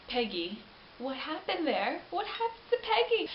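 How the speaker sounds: high, rising cry of disbelief; dismayed outcry. Real, not acted. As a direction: wail the question in disbelief. Dismayed cry about Peggy's fate.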